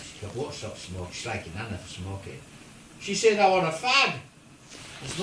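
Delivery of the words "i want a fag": mum's loud demand, retold. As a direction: blurt it loudly, pitch up. Mum demands a cigarette.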